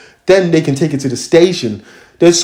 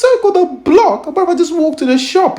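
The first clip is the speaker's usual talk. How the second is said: high-pitched, indignant disbelief; incredulous protest; 13 semitones higher than usual. Retold or imitated. Replying to officer's accusation, high incredulous protest. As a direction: shoot the voice right up in protest.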